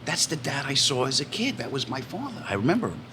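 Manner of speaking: quietly amazed at seeing dad; hushed wonder. Retold or imitated. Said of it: "I'm like".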